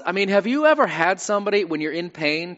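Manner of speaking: ordinary delivery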